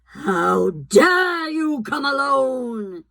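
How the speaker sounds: slow, menacing queen's rebuke; cold fury at the boy; terrible voice. A narrator's reading. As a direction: witch scolding Edmund in a terrible voice. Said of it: "said the witch in a terrible voice".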